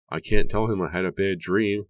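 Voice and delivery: a touch of panicked wailing; wailed. A narrator's reading. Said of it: "Leah wailed".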